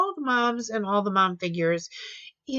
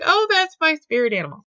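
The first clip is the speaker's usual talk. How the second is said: higher and louder, a delighted squeal of recognition; excited gush; 8 semitones higher, 7 dB louder than usual. Retold or imitated. Crafter gushing over each new stamp, excited squeal. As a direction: gush like you just found your favourite thing. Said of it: "I'm like".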